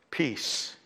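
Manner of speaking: low, soft, hushed word; calming command. Acted. Jesus speaks to the raging storm, calm and hushed. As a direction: speak it low and gently, falling.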